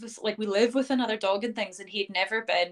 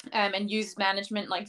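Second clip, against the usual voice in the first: ordinary delivery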